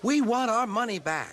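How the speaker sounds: high indignant crowd demand; shouting. Acted. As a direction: call out like angry passengers. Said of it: "passengers were shouting".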